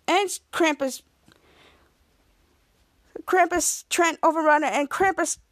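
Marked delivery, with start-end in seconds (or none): none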